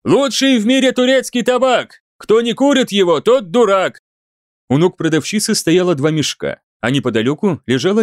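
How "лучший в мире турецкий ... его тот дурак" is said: high, brassy barker cry; loud carnival barker. A narrator's reading. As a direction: bark out the slogan to the crowd. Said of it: "зазывал народ так".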